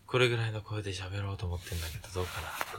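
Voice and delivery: deep, soft low voice. Shown as a demonstration.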